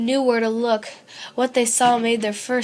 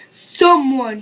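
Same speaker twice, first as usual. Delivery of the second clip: growled; 3 semitones higher, 8 dB louder than usual. A narrator's reading. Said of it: "he growled".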